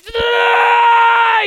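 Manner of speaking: long, loud, high groan; drawn-out exaggerated "ugh"; strained groan. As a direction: groan as if straining past a snag. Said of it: "you have to pretend like".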